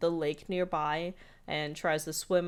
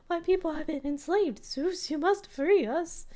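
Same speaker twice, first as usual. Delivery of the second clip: much higher, solemn mock plea; high duck voice; 12 semitones higher, 4 dB louder than usual. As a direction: plead like a tiny oppressed duck. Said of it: "And they go".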